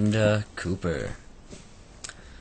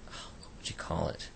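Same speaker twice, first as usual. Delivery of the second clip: whispered aside; 10 dB quieter than usual. Real, not acted. Mutters aside to self.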